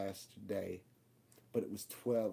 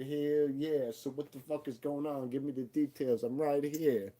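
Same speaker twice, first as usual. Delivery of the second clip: anxious loud plea; 5 semitones higher, 4 dB louder than usual. Retold or imitated. Scared son demands the details.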